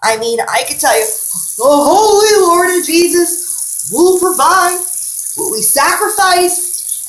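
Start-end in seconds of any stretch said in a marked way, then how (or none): 1.1-7.1 loud, drawn-out preacher's cry; mock preaching. Retelling how bus passengers would not stop preaching, mock preaching. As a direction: imitate bus preachers, high and slow. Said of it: "these people won't stop preaching".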